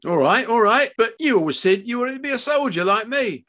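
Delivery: high, loud argument; heated concession. Acted. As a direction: push back hard at your son. Father arguing with son.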